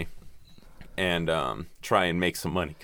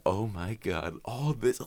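mock amazement; 3 semitones higher, 5 dB quieter, slower than usual. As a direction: imitate a hushed, giddy marvel, pitch rising sharply. Voices film character's giddy measuring.

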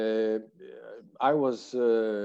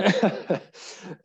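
short laugh; 7 semitones higher than usual. Real, not acted. Laughs after his story.

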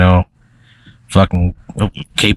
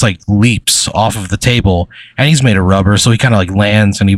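ordinary talk

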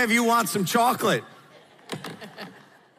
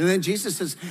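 ordinary talk

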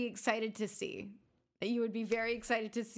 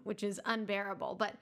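normal talking voice

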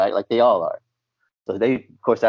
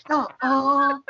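put-on child's disbelief; 14 semitones higher than usual. Kids' disbelief imitated.

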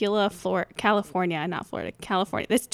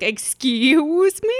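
drawn-out, higher mock outrage; indignant airline caller; 5 semitones higher, 3 dB louder, much slower than usual. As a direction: stretch it out in affronted disbelief. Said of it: "someone on the airline's like".

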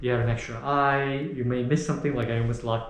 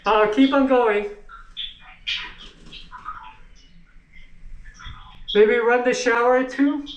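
raised, higher voice calling out; yelling across the room; 9 semitones higher than usual. Real, not acted. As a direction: call it up to the next floor. Calling instructions to another floor.

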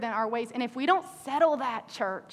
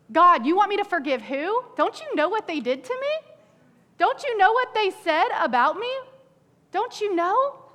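whiny, higher protest that climbs; mock complaining to God; 6 semitones higher, 4 dB louder than usual. Preacher acts a hurt believer arguing with God, whiny.

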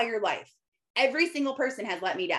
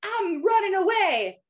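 put-on kid running away; 9 semitones higher, 5 dB louder than usual. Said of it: "your kid packs a bag and it's like".

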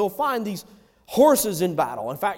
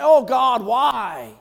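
mock whine; 5 semitones higher, 6 dB louder, much slower than usual. Mocking complaint to God.

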